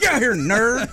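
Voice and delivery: high, loud laughing preacher call; Baby Billy voice. Retold or imitated. Impersonating Baby Billy calling out.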